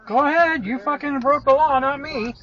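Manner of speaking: loud, high furious yell; angry shout. Real, not acted. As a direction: yell at the lawbreaking driver. Driver cut off, yelling at SUV.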